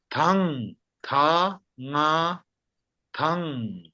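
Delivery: "tang" broken into "ta, nga", then joined as "tang"; spelled-out syllables. Shown as a demonstration. Teacher repeats the word for a student, "tang, ta, nga, tang" sounded out. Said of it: "Can you say it again".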